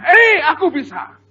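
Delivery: high, loud and slow boast; triumphant yell. Retold or imitated. Child shouting to the crowd, loud slow triumph. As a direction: yell it out proudly. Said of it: "Dia teriak dengan".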